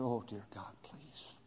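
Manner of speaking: soft, low whispered prayer; preacher retelling his whispered plea for forgiveness; whispered repentance. Retold or imitated. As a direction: breathe it out in shame. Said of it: "I said".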